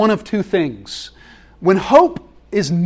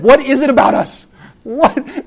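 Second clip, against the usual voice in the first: incredulous; 7 semitones higher, 5 dB louder than usual. Real, not acted. Pastor exclaims in disbelief.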